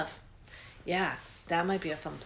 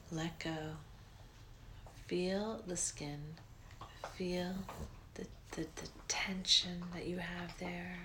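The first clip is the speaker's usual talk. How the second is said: breathy guided relaxation, unhurried; soft spa whisper; 11 dB quieter than usual. Acted. Leads a guided meditation.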